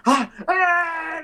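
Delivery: high panicked kobold shrieks; panicked squeal. Acted. Kobolds freak out.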